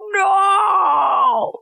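high shouted movie-style no; mock anguished no. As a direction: scream it like the big reveal. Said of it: "Or something".

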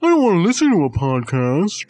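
dopey whining voice put on the listener; a hammed-up mimic of a rude listener; mocking rude listener. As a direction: sneer it like a rude listener who won't shut up. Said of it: "This is your voice".

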